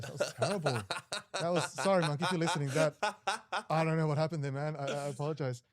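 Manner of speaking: ordinary delivery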